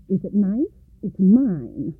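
snatch at it greedily; eager grabbing. A narrator's reading.

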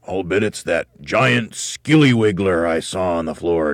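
loud, drawn-out ominous warning; teasing scare. A narrator's reading. Mr. Twit winds up his frightened wife, teasing scare. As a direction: draw it out to frighten her.

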